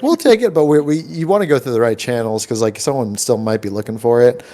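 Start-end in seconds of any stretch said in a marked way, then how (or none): 0.0-0.5 high, keen offer; lightly voiced eager neighbors. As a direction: jump in eagerly. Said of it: "people like".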